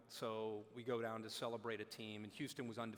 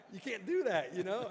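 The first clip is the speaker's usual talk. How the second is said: higher, louder you can't do that; half-laughing disbelief; shocked protest; 8 semitones higher, 11 dB louder than usual. Retold or imitated. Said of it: "I'm like".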